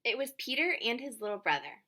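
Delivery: lively picture-book reading with voiced characters; reading to children. A narrator's reading. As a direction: read it out warmly, playing each child.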